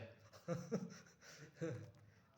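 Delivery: a high breathy giggle; embarrassed laugh. Real, not acted. Apologising for rambling, with a sheepish high giggle. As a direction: laugh at yourself.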